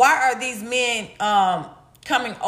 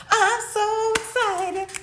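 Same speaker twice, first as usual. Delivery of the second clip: drawn-out, higher squeal of excitement; giddy excited; 10 semitones higher, much slower than usual. Real, not acted. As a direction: stretch it out, thrilled.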